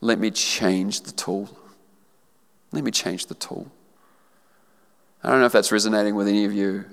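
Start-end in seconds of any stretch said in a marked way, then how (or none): 0.0-1.5 lower, slow and soft; tender divine voice. Acted. The Lord's words first take. 2.7-3.7 soft quiet repeat; hushed tender echo. Acted. Repeating the Lord's words.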